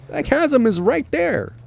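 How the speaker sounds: normal talking voice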